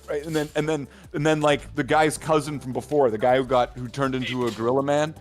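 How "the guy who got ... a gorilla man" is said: ordinary delivery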